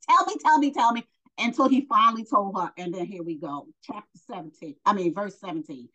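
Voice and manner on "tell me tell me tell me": higher, louder pestering repeats; insistent, needling retold plea; mock nagging. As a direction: imitate someone badgering over and over. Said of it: "nagging for days".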